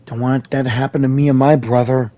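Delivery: ordinary talk, nothing marked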